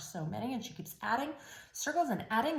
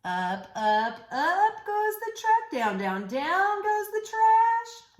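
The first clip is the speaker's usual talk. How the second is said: rising singsong; 7 semitones higher, 4 dB louder, slower than usual. Acted.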